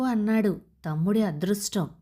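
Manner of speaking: ordinary delivery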